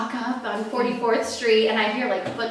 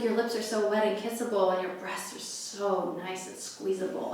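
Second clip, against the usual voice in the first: low, soft sleazy murmur; sleazy come-on; 4 semitones lower, 12 dB quieter than usual. Retold or imitated. Comic imitating the muscle guy's soft sleazy murmur. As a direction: purr it like a creep leaning in.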